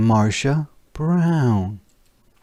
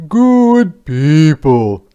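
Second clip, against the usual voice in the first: loud, slow, higher call; 7 semitones higher, 10 dB louder, much slower than usual. Acted. Soldier calling villagers together, loud slow call. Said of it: "the first soldier called out".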